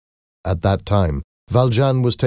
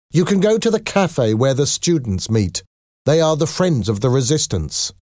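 high-pitched landlady's reply; 7 semitones higher than usual. A narrator's reading. Landlady directing a visitor, high-pitched. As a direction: answer in a high, lifted voice.

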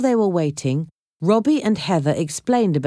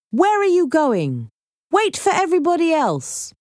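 shouting; 12 semitones higher than usual. A narrator's reading. Jake calls after the two running off, shouting. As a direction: call after them in a raised pitch. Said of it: "Jake shouted".

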